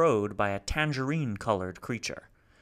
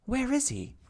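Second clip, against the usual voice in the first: urgent. A narrator's reading. Beatrice asks urgently.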